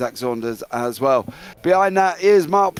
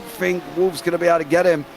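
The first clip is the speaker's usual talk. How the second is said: raised, urgent race-call voice; excited play-by-play; 4 semitones higher than usual. Acted. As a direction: call the finish as it unfolds. Live race finish commentary.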